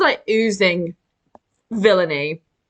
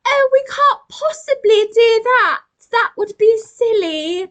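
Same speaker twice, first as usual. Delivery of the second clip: very high, hammed-up RP British monkey; posh RP British accent; 14 semitones higher than usual. Monkey refusing politely, exaggerated RP British accent. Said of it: "a R P British accent".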